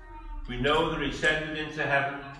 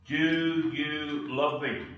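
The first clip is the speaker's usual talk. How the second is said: slow gentle question; much slower than usual. Acted. Jesus asks Peter gently.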